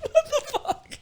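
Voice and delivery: retelling a mate's reaction at the club, laughing; laughing what the fuck. Retold or imitated. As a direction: crack up while quoting his reaction.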